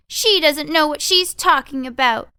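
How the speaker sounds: high, loud, scornful squeak; mocking mice. Mice mocking Tweez as she leaves, high and scornful. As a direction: sneer behind her back.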